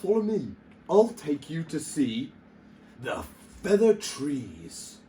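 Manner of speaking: high, woozy owl voice; dizzy owl. A narrator's reading. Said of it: "said the dizzy owl".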